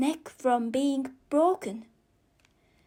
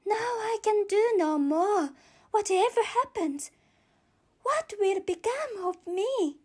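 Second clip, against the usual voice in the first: put-on higher girlish voice, helpless; despairing Alice; 7 semitones higher than usual. Alice gives up, stuck in the house, forlorn. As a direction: plead to nobody like a stuck child.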